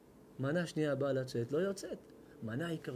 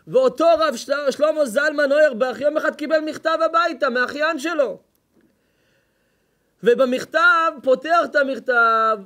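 much louder, higher, full-force sermon shout; fiery preaching; 11 semitones higher, 16 dB louder than usual. Acted. Preacher telling the congregation of the rabbi's letter, fiery shout.

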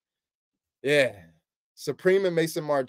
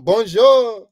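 put-on French accent; 4 semitones higher, 7 dB louder than usual. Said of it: "Paris with the French accent".

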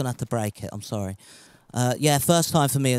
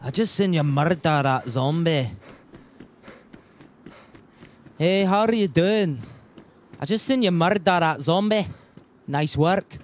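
put-on Scottish with rolled r's; rehearsed, hammy Scottish accent; Scottish accent attempt. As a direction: greet a stranger in an amateur Scottish accent. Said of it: "I tried to do a Scottish accent".

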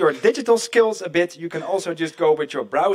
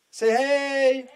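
higher, drawn-out call for a response; rally the crowd to answer back; calling to the audience; 5 semitones higher than usual. Real, not acted. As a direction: sing out a greeting prompt.

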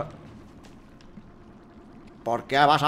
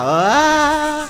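loud, high drawn-out "aah"; sudden sustained cry; long yell; 8 semitones higher, 5 dB louder than usual. Real, not acted. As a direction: yell out one held high note. Game death cry, held high.

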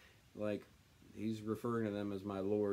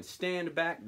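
raised, higher bark of stand back; mob shouting; 7 semitones higher, 4 dB louder than usual. Acted. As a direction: shove them off with the words. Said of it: "they shouted".

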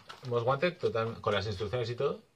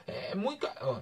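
much higher mock Maghrebi-accented seller; imitating Maghrebi accent; 14 semitones higher than usual. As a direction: play the street seller with a put-on Maghrebi accent, high-pitched. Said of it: "era magrebí".

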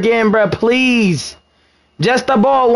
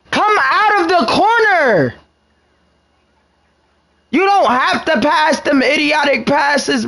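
high, yelled at a teammate; exasperated yelling at the screen; frustrated shout; 6 semitones higher than usual. Real, not acted.

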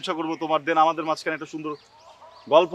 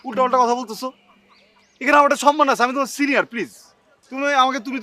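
loud, higher, affronted plea; indignant protest; 5 semitones higher, 6 dB louder than usual. Acted. Senior demanding respect from his girlfriend, indignant. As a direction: insist loudly on your dignity.

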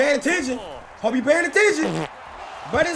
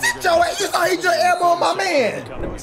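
high squealed taunt easing into a shout; excited trash talk; 6 semitones higher than usual. Real, not acted. Gamer bragging about an elbow hit, high excited shout. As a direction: squeal it at the opponent.

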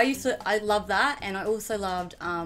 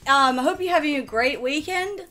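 higher, louder YouTuber intro voice; Lachlan; 5 semitones higher, 7 dB louder than usual. Acted. Sister imitates brother's video intro, upbeat host voice. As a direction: greet the viewers like an upbeat vlogger. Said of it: "That was much, that was much better than Mitchell's".

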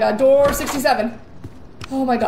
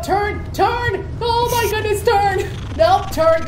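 panicked urging; 8 semitones higher, slower than usual. Real, not acted. Urging character to turn while chased.

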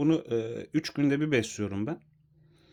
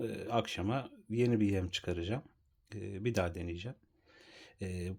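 normal talking voice

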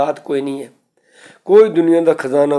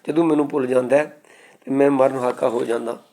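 normal talking voice

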